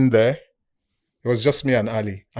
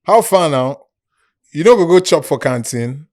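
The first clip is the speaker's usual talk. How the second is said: coworkers' Nigerian Pidgin, a bit louder; Pidgin English; 4 semitones higher, 7 dB louder than usual. Retold or imitated. Coworkers inviting him to the canteen, in Nigerian Pidgin. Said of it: "so they will speak Pidgin".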